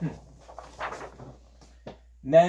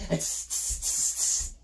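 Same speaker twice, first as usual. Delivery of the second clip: short hissed "tss" sounds; repeated sharp voiceless "tss"; demonstrated monkey chatter; slower than usual. Shown as a demonstration. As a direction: show children the monkeys' hissing. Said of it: "stomp your feet at me and say".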